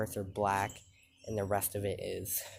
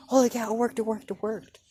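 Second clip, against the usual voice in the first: much higher and louder, thrilled; excited squeal; 13 semitones higher, 8 dB louder, faster than usual. Retold or imitated. Girl cheering her first tail, high excited squeal. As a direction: squeal it like a kid who just pulled it off.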